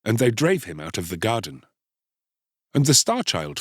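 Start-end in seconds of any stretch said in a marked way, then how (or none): none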